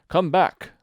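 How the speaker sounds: loud, high call; calling after. A narrator's reading. Caterpillar to Alice as she turns away, calling after. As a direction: call out to someone leaving. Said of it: "the caterpillar called after her".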